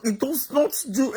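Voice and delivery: ordinary talk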